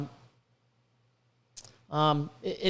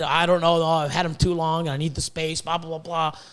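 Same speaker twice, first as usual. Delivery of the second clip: mocking whiny seller; 7 semitones lower than usual. Mocking the seller's excuses to viewers, lower and whiny. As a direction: mimic his lame excuses, dismissive.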